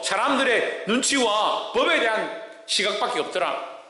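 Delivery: ordinary talk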